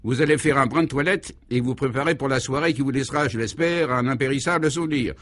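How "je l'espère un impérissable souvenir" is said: normal talking voice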